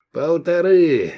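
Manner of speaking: high, slow counter-offer; haggling bid. A narrator's reading. Buyer raising his bid a little, haggling. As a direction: stretch the bid out grudgingly.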